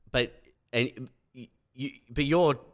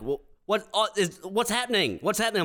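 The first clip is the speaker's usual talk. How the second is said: higher, fussed parent voice; mock confused parent; 10 semitones higher than usual. Parents finding viruses on their computer, mock confused voice. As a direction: act baffled by the computer. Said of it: "they're like".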